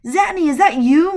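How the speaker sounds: high, loud delighted surprise; surprised greeting. A narrator's reading. Mother Goose recognising Xanthus, high and loud with surprise. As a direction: spot an old friend with a cry of joy.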